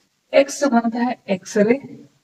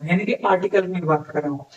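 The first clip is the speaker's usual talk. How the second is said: ordinary talk, nothing marked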